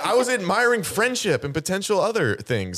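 high-pitched, amused protest; flustered, laughing excuse; laughing self-defence. Real, not acted. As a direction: defend yourself through laughter. Teased, defends himself amid laughter.

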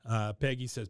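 ordinary delivery

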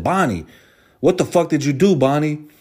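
high, loud, shocked demand; shocked grandma. Retold or imitated. Grandma opening the car door, loud and shocked. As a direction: call out in alarm like a startled grandma. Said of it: "instantly see me and said".

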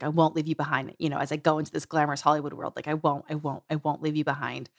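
softly promising; tender, softly. Retold or imitated. Tender promise to inner child.

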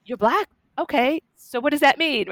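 high, baffled questioning; genuinely confused, probing; puzzled. Retold or imitated. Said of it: "My mother was like".

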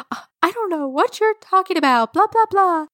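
high, fast fake whine; hammed-up innocent protest, high-pitched; mock tearful denial. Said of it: "Let me fake cry".

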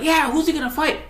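imitating fight commentators in a high put-on voice; hyped announcers. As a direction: play both commentators chiming in with hype. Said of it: "both the announcers were like".

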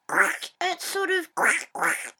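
a lightly voiced real duck sound; duck imitation. Boy showing dad what a real duck sounds like, lightly quacked. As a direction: show how a duck really sounds. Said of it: "they sort of make a".

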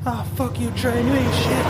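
a scoffing hater jeering at a passing train; mock yelling at a train. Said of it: "someone's yelling at that train".

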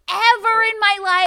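high, loud climax of a rant; indignant outburst. Real, not acted. Podcaster ranting about unsolicited corrections, indignant. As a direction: build to an emphatic loud protest.

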